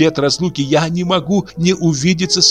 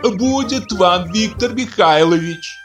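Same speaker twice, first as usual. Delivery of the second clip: much higher, slow soothing woman's call; calling from window; 11 semitones higher, slower than usual. Acted. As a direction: call down to calm him. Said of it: "крикнула из окна".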